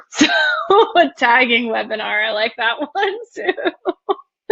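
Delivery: laughing through the words; drawn-out, amused, breaking into a laugh. Real, not acted. Host replying to an attendee's tagging webinar idea, laughing.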